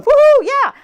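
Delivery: loud, high mock cheer; mock whooping. Wife imagining the cheer her husband isn't giving, mock whooping. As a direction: whoop it up in imitation. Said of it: "He's not over there going".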